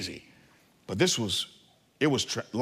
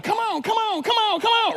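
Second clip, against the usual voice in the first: put-on much higher child voice urging; eager little girl. Daughter urging scared dad up the zip line stairs, high child voice. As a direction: cheer Daddy on like a small brave girl.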